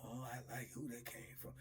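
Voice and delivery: lightly voiced leering inmate; soft leering voice. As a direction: purr it like a leering admirer. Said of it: "one of them old Bama's be like".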